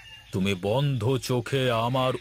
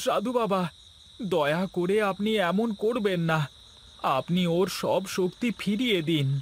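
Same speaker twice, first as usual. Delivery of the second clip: much higher, imploring voice; pleading for mercy; 9 semitones higher than usual. Acted. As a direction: beg the saint to relent. Gopal begs mercy for Sujoy. (উঁচু গলায় করুণ মিনতি)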